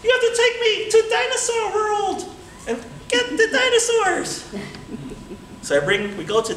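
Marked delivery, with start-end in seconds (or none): 0.0-4.4 high, cartoonish little monkey voice; talking monkey. Caged monkey begging the boy to free him, high voice. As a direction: squeak it out like a tiny caged monkey.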